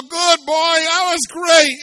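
high, loud, triumphant cheer; gleeful shout. Retold or imitated. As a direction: crow it like you won. Gloating shout at brother's payback. (grito eufórico y burlón)

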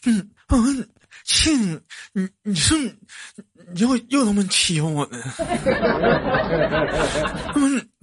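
high stammering whining villager; high whiny impression; Zhao Si impression. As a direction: whine high about being bullied. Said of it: "这是谁".

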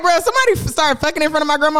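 high mock outrage at grandma's expense; high-pitched outrage. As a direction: act it out shocked, voice jumping high. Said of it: "I was like".

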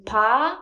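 "pa-ля-ны-ца" syllable by syllable, with soft "ля". Shown as a demonstration. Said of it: "pronounce the soft la, as we say".

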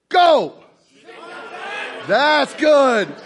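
loud, high hype yelling; shouting the crowd on. Real, not acted. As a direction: pump up a roaring room. Pushes crowd to shout wives' praise.

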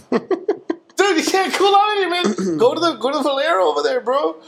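high, laughing mock scolding; exasperated clerk. Clerk telling the loiterer to go to Valero, high and exasperated. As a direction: shoo him out in a high exasperated voice.

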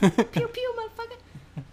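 very high squeaky laser gun noises; cartoon pew pew. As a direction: squeak it like a toy blaster. Mock laser gun sounds.

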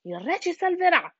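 high, confident exclamation; hen's exclamation. A narrator's reading. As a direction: exclaim it as a hopeful hen. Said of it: "esclamò la gallina Penelope". (gallina che esclama, voce acuta)

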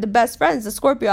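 ordinary talk

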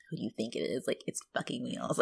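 low, very soft grumble; near-whispered imitation; mock muttered aside. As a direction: mutter it privately to a husband. Grandma's aside to her husband.

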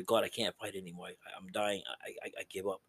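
fading from soft to barely there; giving up. Retold or imitated. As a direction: let the voice sink away, exhausted. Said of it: "dying man giving up the fight".

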